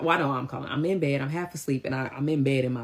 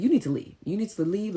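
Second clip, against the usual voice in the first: raised, insistent command; retold firm order; 7 semitones higher, 3 dB louder than usual. Host telling the man to leave, firm order. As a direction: order him out at a higher pitch. Speaker retells ordering him out.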